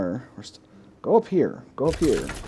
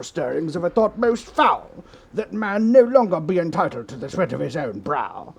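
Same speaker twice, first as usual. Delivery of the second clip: put-on loud, higher, overblown English accent; hammed-up English accent; 7 semitones higher, 7 dB louder than usual. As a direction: ham up the English announcer, dramatic doom. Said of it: "let's ham it up a little bit more".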